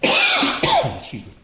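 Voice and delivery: croaky after a cough; clearing a tickly throat; throat tickle. Real, not acted. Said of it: "a little tickle".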